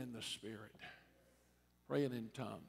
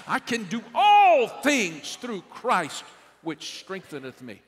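proclaimed loud; 7 semitones higher, 17 dB louder than usual. Shown as a demonstration. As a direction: cry it out with full conviction. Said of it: "One more time".

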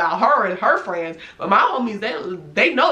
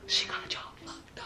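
hushed, voiceless whisper; imitating a whispered reaction; retold whisper; 16 dB quieter than usual. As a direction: whisper it like a scandalized aside.

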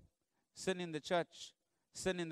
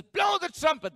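loud, high called order; ringing command; 13 semitones higher, 9 dB louder than usual. Acted. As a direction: call it out across the army. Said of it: "He says".

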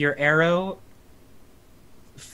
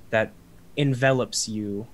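ordinary delivery

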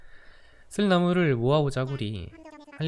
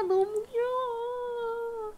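very high, very slow cooing squeal; gushing over cuteness; 4 dB quieter, much slower than usual. Real, not acted. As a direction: melt over it in a long high coo. Gushing over cute villager.

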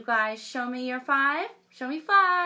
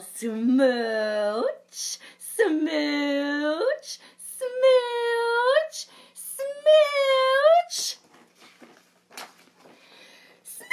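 building from a normal pitch to a very high, louder squeal; each smooch climbs higher and louder; yelled smooches; 10 dB louder, much slower than usual. Acted. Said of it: "I yell".

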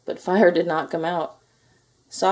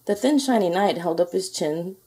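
usual speaking voice

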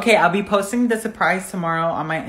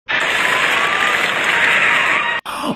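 long, high gasp; panicked gasp. Acted. Passengers about to die in a skit, high panicked gasp. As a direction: suck the air in sharply, high and drawn out.

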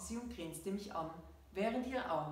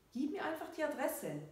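much higher, mildly impatient demand; insistent; 9 semitones higher than usual. A narrator's reading. Friend insists on coming to help, impatient. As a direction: cut off the protest and insist.

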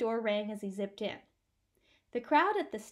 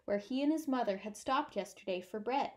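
usual speaking voice

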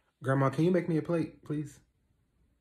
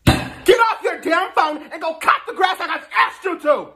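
furious high-pitched scream; stern yell; 5 dB louder than usual. Acted. Stepmother to defiant stepson refusing chores, stern furious yell. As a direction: scream the order at full force. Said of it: "Was that your stern voice".

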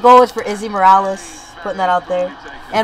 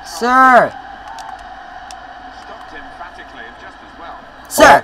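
calling out; 2 semitones lower than usual. Real, not acted. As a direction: call out to get someone's attention. Repeatedly calling out during game.

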